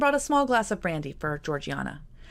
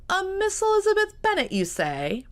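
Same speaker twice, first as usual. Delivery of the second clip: sudden high mock-astonished squeak; mock surprise; 5 dB louder than usual. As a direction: ham up fake amazement, pitch leaping high and loud. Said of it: "made a face of mock surprise".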